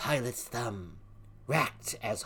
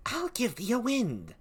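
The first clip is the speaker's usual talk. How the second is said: "I'll give thee a wind" jumped up high; shrill witchy offer of wind; high cackling offer; 12 semitones higher than usual. Acted. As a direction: swoop up on "I'll". Same offer, pitched high.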